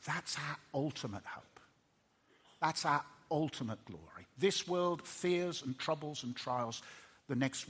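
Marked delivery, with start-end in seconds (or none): none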